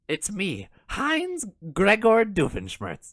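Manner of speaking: high, slow cartoon villain introduction; Doofenshmirtz. Gregor recast as Doofenshmirtz introducing himself, high cartoon German accent. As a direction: present yourself as a cartoon German professor. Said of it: "That's a good model".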